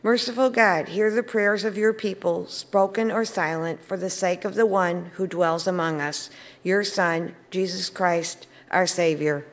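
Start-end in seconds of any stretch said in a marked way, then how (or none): none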